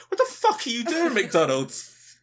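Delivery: high, loud mock outrage; appalled outburst. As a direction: shriek it like a horrified customer, half laughing. Acted appalled customer shouting at McDonald's.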